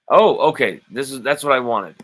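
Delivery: high, loud burst of surprise; light up as you finally spot it; pleased, loud oh okay; delighted surprise. Real, not acted. Finds the chat comment he wanted.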